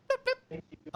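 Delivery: high mock electronic beep; beeping noise. Lock chirping as the door opens, high beeps. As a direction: squeak out a beep beep. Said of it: "and you hear".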